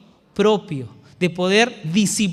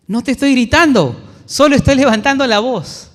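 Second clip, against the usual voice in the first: loud, high, insistent protest; retold raised voice at his mother; 6 semitones higher, 8 dB louder than usual. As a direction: argue back loudly, sure you're not yelling. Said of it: "en tono de voz elevada".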